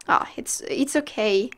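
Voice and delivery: ordinary delivery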